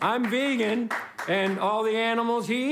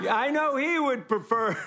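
normal talking voice

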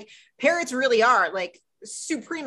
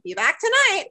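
higher parroting echo; 8 semitones higher, 3 dB louder than usual. Retold or imitated. Parrot echoing Ethan's goodbye, pitched higher. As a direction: mimic the parrot repeating the phrase back. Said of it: "He's like".

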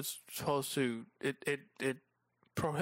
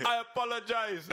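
put-on laughing stab at a Jamaican accent, higher; attempted Jamaican accent; 7 semitones higher than usual. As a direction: try a Jamaican accent through giggles. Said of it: "I can't even get it into the accent".

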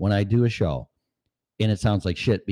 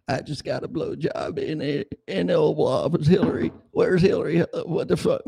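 much higher put-on ex-president voice; politician impersonation; 9 semitones higher than usual. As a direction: ham up the scandal denial, pitched way up.

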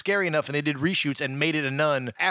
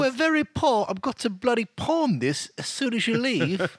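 higher mock English accent; 6 semitones higher than usual. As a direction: complain like a poor Brit. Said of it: "Things are different in England".

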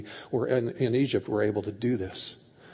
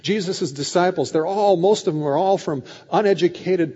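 ordinary talk, nothing marked

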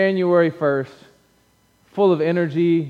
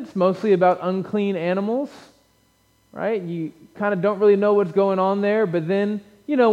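usual speaking voice

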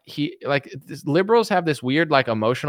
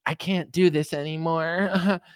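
slow, soft, higher put-on voice; imitating the pastor; 4 semitones higher, 4 dB quieter, slower than usual. The pastor walking out on Jesse, slow soft put-on voice. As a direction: give up, drained and defeated. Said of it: "the guy will get up and be like".

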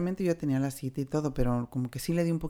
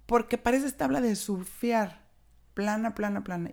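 higher, slow sneering jab at a flat chest; mocking taunt; 6 semitones higher than usual. Angry neighbor taunting a teenage girl, mocking and higher. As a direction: drag out plana like a playground insult. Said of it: "una pinche vecina cabrona que se enojó conmigo".

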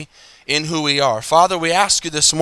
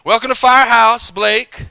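loud, high greeting by name; shouted welcome; 7 semitones higher, 7 dB louder than usual. Retold or imitated. Staff greeting the pastor by name, loud and high. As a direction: call the regular's name out cheerfully. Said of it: "every time I'd walk in".

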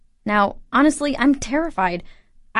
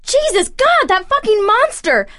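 furious; 8 semitones higher than usual. Acted. Rage at ex-husband.

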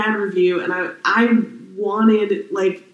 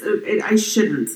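ordinary delivery

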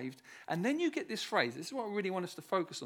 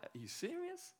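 mock incredulous; 11 semitones higher, 11 dB quieter than usual. Voicing doubter's objection.

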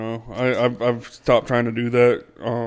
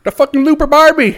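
loud high Aussie shout; shouted mock Australian accent; 13 dB louder than usual. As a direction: yell it like a cartoon Aussie. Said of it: "We are so horrible".

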